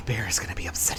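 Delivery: worried whisper. Acted. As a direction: whisper it to yourself.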